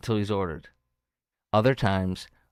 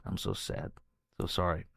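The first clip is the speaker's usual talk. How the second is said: sad, much softer near-whisper; real sadness; 8 dB quieter than usual. Real, not acted. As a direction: let the grief hush your voice. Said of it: "I'm getting a little emotional".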